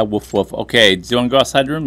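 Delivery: ordinary delivery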